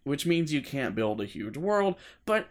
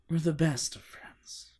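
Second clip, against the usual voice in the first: lightly creepy friendly tone; creepy Jeff voice; 4 semitones higher than usual. Acted. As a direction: say it with a creepy smile. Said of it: "he said".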